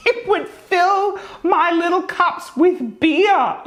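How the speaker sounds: high, slow, words breaking into laughter; can't get it out for laughing. Real, not acted. Cracking up mid-story.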